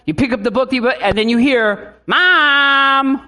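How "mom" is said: put-on loud high call of a child from bed. Child calling mom from bed, loud high call. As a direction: call out like a kid at bedtime.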